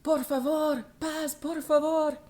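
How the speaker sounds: loud high plea in Spanish; pleading Spanish shout. Retold or imitated. Shouting at squabbling geese in Spanish, loud and high. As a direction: call out loudly to the fighting geese. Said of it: "I said".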